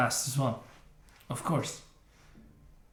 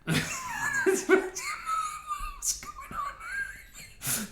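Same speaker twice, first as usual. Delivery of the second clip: laughing; 5 dB quieter, much slower than usual. Real, not acted. Laughing at the cutscene.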